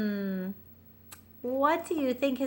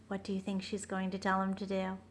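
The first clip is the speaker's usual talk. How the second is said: ordinary delivery, nothing marked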